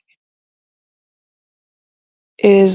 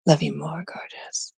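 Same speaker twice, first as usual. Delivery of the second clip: soft whisper; 3 semitones lower, 10 dB quieter than usual. Real, not acted. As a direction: whisper it tenderly. Whispered endearment.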